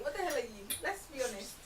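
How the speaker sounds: ordinary talk, nothing marked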